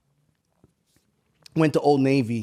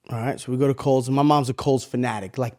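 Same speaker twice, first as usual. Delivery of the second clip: usual speaking voice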